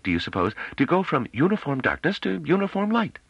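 high-pitched musing voice; thoughtful scholar. A narrator's reading. Mythologist speculating to his companion about night lights, thoughtful. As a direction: think a theory through aloud, pitched high. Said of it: "Palarat said thoughtfully".

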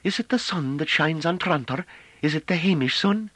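high-pitched, hesitant character voice; tentative, high questioning; timorous woman. A narrator's reading. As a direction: ask meekly in a high woman's voice. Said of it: "after an irresolute pause".

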